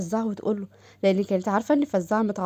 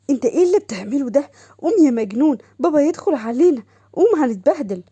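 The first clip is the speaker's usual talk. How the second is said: put-on alarmed girl; 4 semitones higher, 6 dB louder than usual. Doha alarmed, pushing him off.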